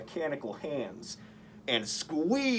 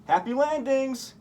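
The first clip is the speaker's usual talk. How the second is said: much higher, louder playful send-off; gleeful taunt; 11 semitones higher, 6 dB louder than usual. A narrator's reading. Spider-Man mocking the crashing thug, high gleeful taunt. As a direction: cheer him down like a cocky hero. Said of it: "Spider-Man said".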